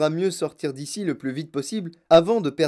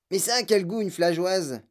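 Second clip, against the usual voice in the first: put-on higher, puzzled child's question; 4 semitones higher than usual. A narrator's reading. Child interrupting Wonka about the taste, puzzled. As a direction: butt in with a pitched-up question.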